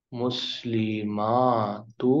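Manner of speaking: المسلماتو drawn out very slowly; slow teaching pronunciation; slow model word. Shown as a demonstration. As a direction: stretch المسلماتو. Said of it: "جمع بناتے".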